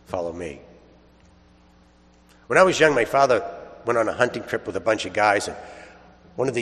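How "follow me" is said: "Follow me" repeated lower and much softer, falling gently; quiet echo. Shown as a demonstration. Preacher repeats Jesus' call to the congregation, hushed. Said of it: "Yeah, you can change the direction".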